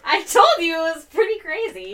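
loud, high, through laughter; laughing. Real, not acted. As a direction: crow it while cracking up. Everyone cracking up at the text.

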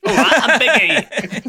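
put-on high, slow, laughing Cockney accent; hammed-up Cockney with a laugh. Mimicking bad Cockney voice acting.

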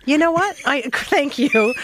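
slow, laughing out a thank you. Real, not acted. Interviewer thanks the elder while laughing hard. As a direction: thank him through real laughter.

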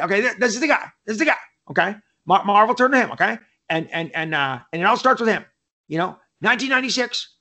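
high, stammering fast-talking agent. Retold or imitated. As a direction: imitate an agent's breathless pitch, tripping over words in high bursts.

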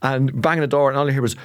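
normal talking voice

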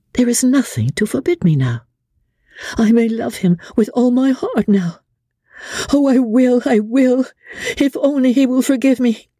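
a heart finally set free; Amelia hopeful. A narrator's reading. Amelia vowing to love Dobbin, high joyful resolve.

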